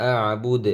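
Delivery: "আ বুদ" cut on a final d, no u; waqf stop. Shown as a demonstration. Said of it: "এসে পেশের উচ্চারণ আর এখানে হবে না". ("আ বুদ" শেষে পেশ ছাড়া ওকফ)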